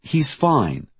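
insistent repetition. A narrator's reading. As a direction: insist on it, pitch jumping then falling. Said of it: "as if that made it more true".

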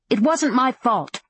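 high, defensive protest; fearful. A narrator's reading. Madam shrinking from an angry man, fearfully. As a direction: back away from blame, pitch jumping up. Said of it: "drawing back in fear".

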